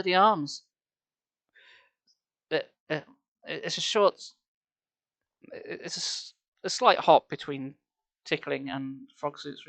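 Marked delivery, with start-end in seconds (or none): none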